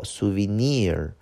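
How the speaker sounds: "souvenir" with the weight on "nir"; sou-ve-NIR, end-stressed; stress on last syllable. Shown as a demonstration. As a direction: land on the final syllable of "souvenir". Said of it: "重音在最后".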